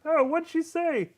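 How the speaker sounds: high, slow, puzzled voice; [name]. Acted. GM as a guard missing his orders, puzzled. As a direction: ask it high and drawn out. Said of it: "[name] goes".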